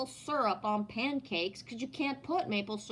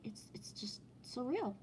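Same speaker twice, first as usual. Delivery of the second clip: whisper; 4 semitones higher, 10 dB quieter than usual. Real, not acted. Trailing musing on fake ads.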